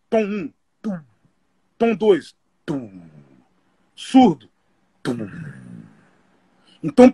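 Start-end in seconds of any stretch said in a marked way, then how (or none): 0.1-1.0 vocal tom-tom hit; imitated tom. Shown as a demonstration. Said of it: "Eu vou fazer pra vocês". 1.8-3.1 vocal floor-ish tom; imitated lower tom. Shown as a demonstration. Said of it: "Eu vou fazer pra vocês".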